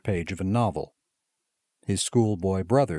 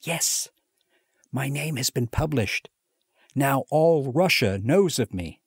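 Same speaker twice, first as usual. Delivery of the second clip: higher, savouring the news; proud, lightly excited; 5 semitones higher than usual. A narrator's reading. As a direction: announce it proudly. Mitya proudly announces.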